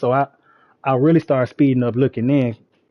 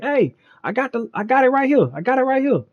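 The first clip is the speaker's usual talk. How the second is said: high, calling out the window; retold calling out hey I got it; calling after him; 10 semitones higher than usual. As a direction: shout to get his attention. Calling out to departing cop.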